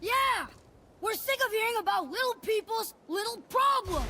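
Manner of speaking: put-on boy snapping at the dwarf, shouting him down; angry shout. Boy mocking the dwarf's troubles, furious shout. Atreus lashes out at Sindri.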